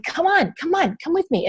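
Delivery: upbeat invitation. Shown as a demonstration. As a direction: draw the viewers along with bright energy. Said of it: "And I'm like".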